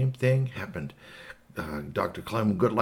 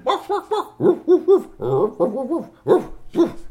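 high, loud volley of mock barks; dog barking; 7 dB louder, slower than usual. Maxie talks some more to Igglypop, imitated loud barking. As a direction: bark away like a dog. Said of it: "it started barking along".

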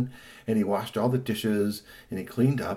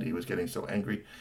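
usual speaking voice

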